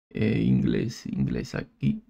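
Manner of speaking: low, soft mutter under the breath; muttered to himself. Real, not acted. Tutor scrolling through keyboard options, muttering quietly. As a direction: murmur it to yourself while searching the list.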